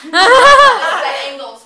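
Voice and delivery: very loud, drawn-out foreign phrase; bellowed phrase demonstration. Acted. Vice president teaching Spanish phrases to the meeting, bellowing. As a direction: belt it out slowly for the class.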